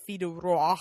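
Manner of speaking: FILLE DU ROI with a pig-like snort on Roi; snorted French R. Shown as a demonstration. Can't roll her R's, so snorts the R in Roi. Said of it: "just gonna snort like a pig".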